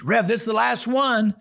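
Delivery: high, pressing report; urgent warning. Retold or imitated. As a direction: tell the Rev in alarm it's the last. Inmate warns hankies are gone.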